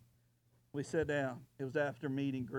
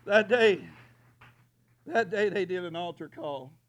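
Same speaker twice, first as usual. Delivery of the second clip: preaching shout; 3 semitones higher, 12 dB louder than usual. Acted.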